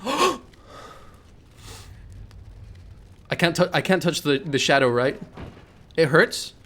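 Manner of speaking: overacted fright, voice jumping up; playing scared for the chat; mock scared horror gamer. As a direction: ham up being scared of the game. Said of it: "React like I'm playing Amnesia?".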